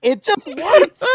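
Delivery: slow, high, loud punchline; excited emphasis. Real, not acted. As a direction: stretch it out in delight.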